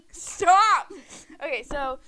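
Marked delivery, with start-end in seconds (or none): 0.1-0.9 loud, high laughing "stop"; laughing shout. Real, not acted. Cutting off her sister's teasing with a loud laughing stop.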